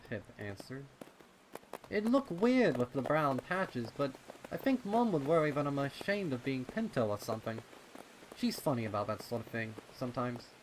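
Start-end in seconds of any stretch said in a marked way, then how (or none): 1.9-10.5 put-on high, loud and fast boyish voice; young colt voice. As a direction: rattle it off like a worried kid. Pip explaining about his mom.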